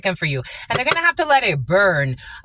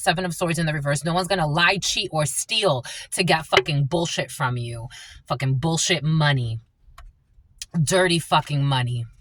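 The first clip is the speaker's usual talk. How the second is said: ordinary talk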